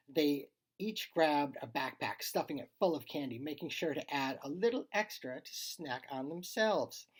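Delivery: plain reading voice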